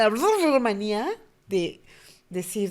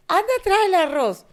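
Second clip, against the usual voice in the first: much higher and louder order; mock bossy mother; 11 semitones higher, 8 dB louder than usual. Mother ordering her daughter to fetch rice, higher and louder. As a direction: send the kid off.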